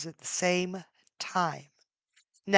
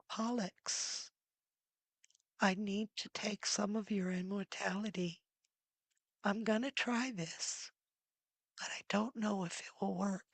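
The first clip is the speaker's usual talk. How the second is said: gentle; 11 dB quieter than usual. Acted. Hebe kneels and speaks gently.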